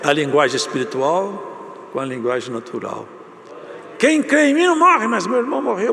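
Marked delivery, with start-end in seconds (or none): 4.0-5.9 mock protest, high and loud; a sister's loud, pitched-up protest. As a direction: cry out the objection, pitched up high. Acting Martha's protest.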